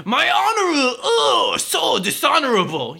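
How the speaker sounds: high, wailing overacted samurai lament; mock samurai drama. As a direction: ham it up like a tragic samurai. Parody of a stereotyped samurai film line.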